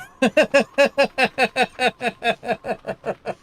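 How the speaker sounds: long, high, loud laughing fit; helpless belly laugh at your own story; real, sustained burst of laughter; cracking up. Real, not acted.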